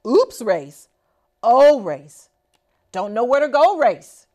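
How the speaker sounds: usual speaking voice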